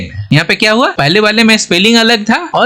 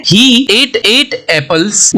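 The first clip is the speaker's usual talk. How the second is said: very slow, deliberate English sentence; slow example read; 4 semitones higher, much slower than usual. Shown as a demonstration. Teacher reading an example sentence slowly to students. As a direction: stretch every word of the example. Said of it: "sentence में use करके समझने की".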